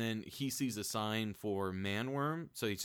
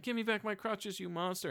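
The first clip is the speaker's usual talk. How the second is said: much higher, hammed-up whine; mock wounded protest; 10 semitones higher than usual. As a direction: play the fake victim. Hero fakes injury, voiced.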